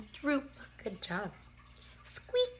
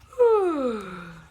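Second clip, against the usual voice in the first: loud whimpering yawn; puppy yawn with a whimper; snoozing puppy yawn; 9 dB louder than usual. Shown as a demonstration. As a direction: yawn like a puppy with a little whine. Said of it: "a snoozing yawn".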